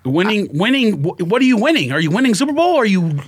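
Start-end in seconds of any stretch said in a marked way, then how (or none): none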